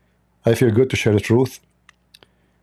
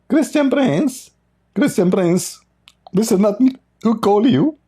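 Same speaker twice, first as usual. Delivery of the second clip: mocking Sabir Ahmed; 9 semitones higher than usual. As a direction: squeak out the name like a whiny caller. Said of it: "Do you remember the guy, his name, uh, Sabir Ahmed?".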